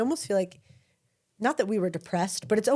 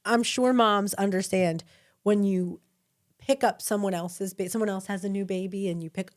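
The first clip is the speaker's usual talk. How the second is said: ordinary talk, nothing marked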